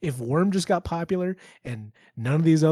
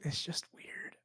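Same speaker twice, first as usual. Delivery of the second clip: whisper; 15 dB quieter, slower than usual. Real, not acted. Hushed aside about old cartoon.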